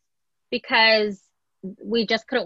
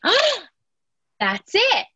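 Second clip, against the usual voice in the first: excited realization; 4 semitones higher, 4 dB louder, slower than usual. Retold or imitated.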